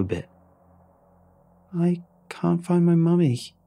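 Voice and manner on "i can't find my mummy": put-on high, slow, tiny little girl's voice; a lost child saying it without hope; hopelessly tiny voice. A narrator's reading. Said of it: "in that same hopelessly tiny voice".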